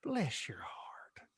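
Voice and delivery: slow unvoiced whisper; whispered sweet jab. Shown as a demonstration. Showing the passive aggressive Southern blessing, whispered. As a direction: whisper it sweetly with a hidden dagger. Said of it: "that's what you get with it".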